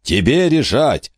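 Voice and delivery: booming over the wind. A narrator's reading. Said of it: "прогремел голос Габриэля".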